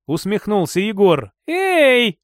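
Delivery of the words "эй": high, indignant protest; indignant cry of offence. A narrator's reading. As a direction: object sharply, pitch jumping up. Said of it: "возмутилась девушка".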